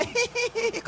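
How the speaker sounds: high imitated snickering; imitating listeners' stifled cackle; mock giggling. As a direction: giggle in a high, squeaky titter. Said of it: "ketawa cekikikan".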